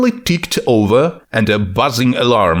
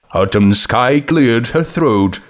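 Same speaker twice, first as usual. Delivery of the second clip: ordinary delivery, nothing marked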